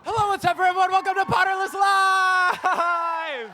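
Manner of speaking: high, loud shout to open the show; восторженный выкрик ведущего. Real, not acted. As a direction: greet a live crowd, stretching out the last word. Host opens the live show.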